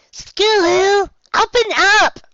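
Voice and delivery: muffled through a door; calling from outside, muffled. A narrator's reading. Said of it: "muffled voice from outside".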